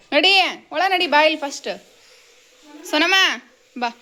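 loud, high command to come in; calling out. Real, not acted. Mother orders her daughter to come inside first, loud high call.

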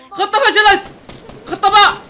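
very loud, high battle yell; alarmed shouting. Real, not acted. Player switches squad to bombs after failed plan, frantic shouting. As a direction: yell the backup orders over chaos. Plan fails, yells backup plan.

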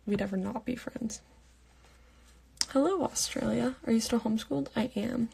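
ordinary delivery

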